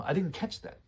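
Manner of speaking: high, friendly lilt ending in a rise; sweet and non-confrontational; almost cute. Shown as a demonstration. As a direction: say it cutely, pitched well up. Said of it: "these phrases sound very, uh, almost cute".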